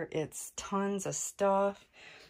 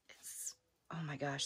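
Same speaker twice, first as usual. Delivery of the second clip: whisper; 4 semitones lower, 12 dB quieter than usual. Real, not acted. Hears something crawling nearby.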